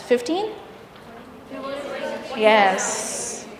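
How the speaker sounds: ordinary talk, nothing marked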